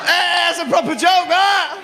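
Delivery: high shout. Acted.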